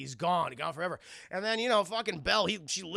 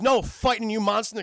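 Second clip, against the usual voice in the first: loud, higher-pitched retold challenge; mock villain shout; 4 semitones higher, 9 dB louder than usual. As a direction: call out the foe, hammed-up. Gaston taunts Beast, acted.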